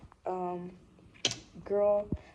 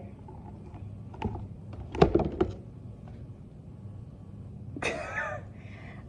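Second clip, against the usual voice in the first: high breathless laugh; 3 dB quieter than usual. Real, not acted. After embarrassing phone call.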